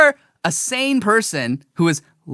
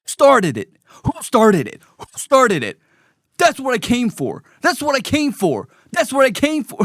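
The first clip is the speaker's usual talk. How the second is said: imitating the protester; 4 semitones higher than usual. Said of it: "eight times he said".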